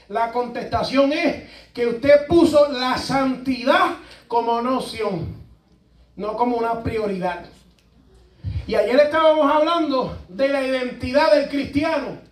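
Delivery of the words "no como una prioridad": usual speaking voice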